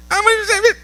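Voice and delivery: very high, spluttered objection; whiny protest. Acted. Believer still objecting to forgiving, whiny protest. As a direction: cut in with a high whine.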